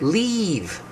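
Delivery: "Leave" with a long, high, tense EE. Shown as a demonstration. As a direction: stretch and stress the vowel in "Leave". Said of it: "stress more here in your mouth".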